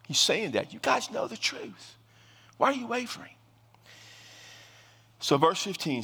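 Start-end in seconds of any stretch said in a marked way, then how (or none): 0.7-3.3 pleading challenge. Real, not acted. Pastor challenging wavering believers.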